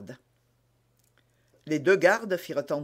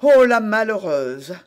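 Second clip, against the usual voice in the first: loud drawn-out lament; exclamation consternée; 6 semitones higher, 10 dB louder than usual. A narrator's reading. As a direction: cry out in dismay, loud on the Oh. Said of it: "s'écria Bonacieux". (s'exclamer avec consternation)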